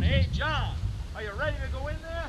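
a coach hollering across the field, half-played; mock yell. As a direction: call it down to the bullpen in a mild mock yell. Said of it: "instead of yelling down".

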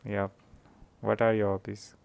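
ordinary talk, nothing marked

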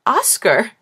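high, loud joyful call of the name; excited call. Acted. Grandmother greeting her grandson, loud joyful call. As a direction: call out "Oscar!" with delight.

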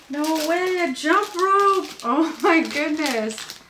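thrilled gasping exclaim; excited. Real, not acted. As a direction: gush over the new gift. Excited unboxing jump rope.

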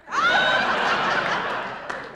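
very high shrieking laugh-shout; real shrieking laughter; screaming laugh. Real, not acted. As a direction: let out a wild cackling shriek.

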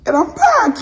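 high laughing punchline; playful joke. Shown as a demonstration. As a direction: squeal it out then laugh.

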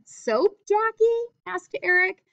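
Jackie rising high and slow on the question; high surprised question, tag kept in the same breath; high questioning read. Shown as a demonstration. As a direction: read "Soap Jackie?" as a high-pitched question, "asked Eric" still high. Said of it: "that's one sentence".

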